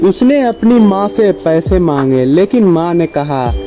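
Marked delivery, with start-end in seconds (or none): none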